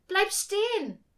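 put-on high, loud child's shout; pleading call. A narrator's reading. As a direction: call out to stop someone leaving. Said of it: "rief sie".

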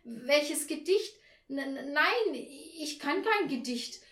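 high, slow, confused stammer; flustered stutter. A narrator's reading. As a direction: stumble over the words, completely thrown. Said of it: "stotterte Frau Schilling".

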